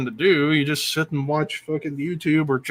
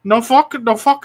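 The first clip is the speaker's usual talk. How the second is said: mock Chinese accent; 8 semitones higher, 5 dB louder than usual. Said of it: "the Chinese food bit".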